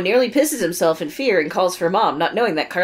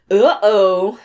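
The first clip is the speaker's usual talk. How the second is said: mock uh-oh; 3 semitones higher, 8 dB louder than usual. Reading dramatic note.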